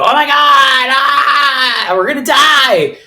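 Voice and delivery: mock the people who freak out, with a hammed-up wail; mock panic. Mocking vaccine-panicked people with an exaggerated panicked wail. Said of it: "freak out about it and go".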